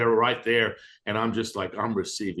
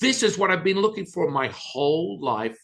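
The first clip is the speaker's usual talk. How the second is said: higher and louder in awe; higher, louder amazed cry at the silence; amazed exclamation; 9 semitones higher, 6 dB louder than usual. Retold or imitated. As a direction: burst out with stunned delight. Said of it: "I said".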